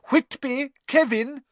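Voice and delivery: high, projected roll call; calling out names. A narrator's reading. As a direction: call the next pupil up. Teacher calls out name.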